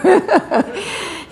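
a sudden high, bright laugh at herself; high burst of laughter. Real, not acted. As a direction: laugh out loud at the memory. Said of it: "it's so funny".

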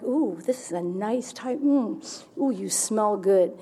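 soft, slow seductive coo; seductress. Retold or imitated. Flirting softly with the neighbor to deflect him. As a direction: purr it softly to win him over. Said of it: "I used to do the seductress".

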